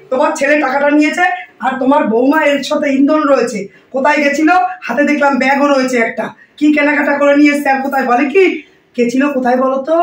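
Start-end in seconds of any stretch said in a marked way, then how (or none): none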